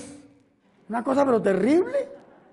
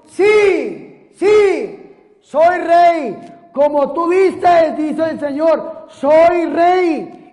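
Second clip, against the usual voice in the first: loud, ringing declaration; proclaiming Jesus; 3 semitones higher, 7 dB louder, slower than usual. Acted. Preacher voicing Jesus to Pilate, in a loud, ringing proclamation. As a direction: proclaim the kingship with full force. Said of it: "Respondió Jesús".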